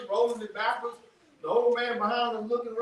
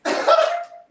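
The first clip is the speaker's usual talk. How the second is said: loud laugh; 8 dB louder than usual. Real, not acted. Laughs at own bit.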